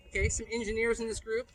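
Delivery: usual speaking voice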